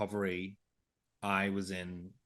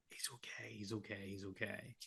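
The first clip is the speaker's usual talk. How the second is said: hushed, breathy whisper, over and over; whispered reassurance; 12 dB quieter than usual. Retold or imitated. Dad silently reassuring Amy on the operating table, whispered. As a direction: whisper it across the room so only she sees.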